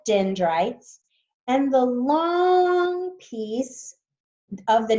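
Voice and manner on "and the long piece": "long" drawn out and lifted high; slow, stretch "long" to show its length; high, slow stress on "long"; stretched emphasis. Shown as a demonstration. Teacher emphasizes length of axon.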